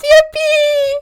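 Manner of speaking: very high, loud squeal; mock cheer. Changing the subject from Genshin talk, mock cheer. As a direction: shriek it out with forced glee.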